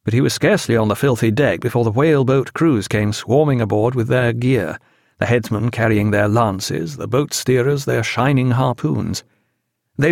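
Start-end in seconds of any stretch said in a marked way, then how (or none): none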